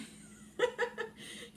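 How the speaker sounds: high, airy giggle burst; grossed-out laugh. Real, not acted. Host laughs at comment about chewing hair, amused giggle.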